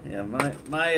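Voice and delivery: usual speaking voice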